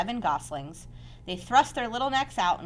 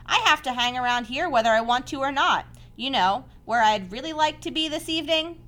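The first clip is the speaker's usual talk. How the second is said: a hint of an eager pig, higher and louder; lightly pitched-up storybook piglet; lightly voiced pig; 6 semitones higher, 6 dB louder than usual. A narrator's reading. Pig confiding in the spider, lightly voiced.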